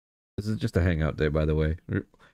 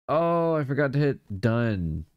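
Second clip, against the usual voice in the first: realisation; 6 semitones higher, slower than usual. Real, not acted. Realising the mistake.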